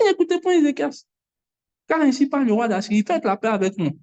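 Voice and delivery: high, proclaiming the first line, then settling down; quoting the enemy king's taunt to the people; taunting the Assyrian king. Retold or imitated. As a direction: ring out the king's taunt, then let it drop. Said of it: "Il dit".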